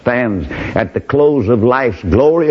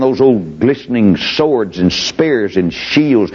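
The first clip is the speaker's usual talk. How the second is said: ordinary delivery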